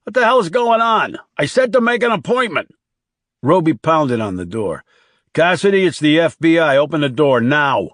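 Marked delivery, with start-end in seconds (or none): 0.0-2.7 indignant raised voice, pitched way up; angry call. A narrator's reading. Boss objects to someone trying his locked door, angry yell. 5.3-7.9 forceful raised voice, higher and louder; commanding shout. A narrator's reading. Agent pounds on door demanding entry, commanding shout.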